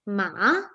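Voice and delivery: "ma" falling, then rising; third tone, dipping. Shown as a demonstration. Teacher shows student the third tone, falling-rising "ma". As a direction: dip the pitch on "ma", then lift it. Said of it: "It falls and then it rises".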